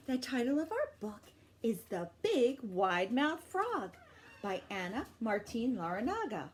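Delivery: usual speaking voice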